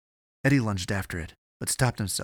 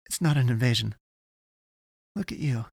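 higher-pitched, anxious insistence; in a panic; 4 semitones higher than usual. A narrator's reading. Avery corrects Eddie while held fast, panicked. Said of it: "she said in a panic".

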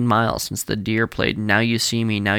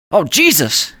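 startled cry, higher and louder; shout; 8 semitones higher, 7 dB louder than usual. Acted. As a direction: blurt it out in shock. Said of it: "Andy shouted".

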